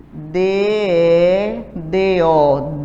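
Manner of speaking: very slow, letter by letter; spelling aloud. Shown as a demonstration. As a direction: drag each letter out for the class. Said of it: "formar a palavra dedo". (soletrando bem devagar)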